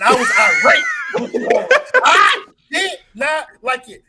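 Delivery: loud laughing mock outrage; irate shout. Retelling being irate at Tony Schwartz.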